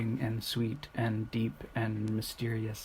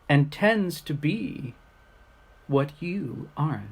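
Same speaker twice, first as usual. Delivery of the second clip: poem reading; 3 semitones higher, 3 dB louder than usual. Acted. Reading short poems to the camera, unhurried and reflective. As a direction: read each line and let it settle. Reads poems aloud to viewers.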